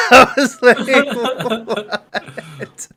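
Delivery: loud, high laughing what; laughing disbelief. Real, not acted. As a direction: burst out in disbelief. Reacting to absurd movie moment.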